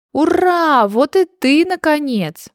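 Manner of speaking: high, delighted cheer; overjoyed. A narrator's reading. Rabbit greets the newly hatched baby, overjoyed. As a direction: glad welcome, voice lifted high. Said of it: "обрадовался кролик".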